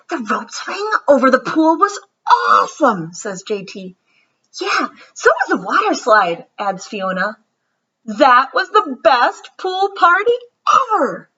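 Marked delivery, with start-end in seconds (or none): 0.1-3.1 put-on high, loud gushing child voice; excited kid. J T raving to friends about the pool party, excited high voice. 4.6-6.5 put-on excited kid. J T raving to friends about the pool party, excited high voice. 8.0-11.3 put-on high, loud gushing kid; excited squeal. Fiona gushing to Madison about her party, excited squeal.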